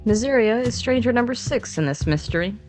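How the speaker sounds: usual speaking voice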